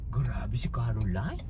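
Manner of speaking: slow secret whisper; hushed, low gossiping whisper. Real, not acted.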